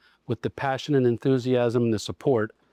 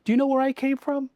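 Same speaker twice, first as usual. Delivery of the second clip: higher, leading question to the employee; pointed question; 12 semitones higher, faster than usual. Retold or imitated. GM questioning the detailer in his office, higher and leading. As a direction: tease out the answer. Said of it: "I go".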